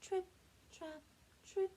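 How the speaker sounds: light high trip trap. Acted. Smallest goat crossing bridge.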